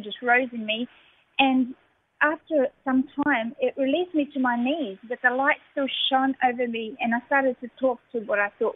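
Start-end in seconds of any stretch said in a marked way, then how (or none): none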